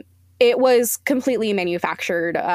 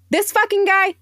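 exasperated outburst; 10 semitones higher, 3 dB louder than usual. Real, not acted. Outraged rant about firing him.

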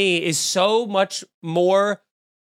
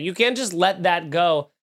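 usual speaking voice

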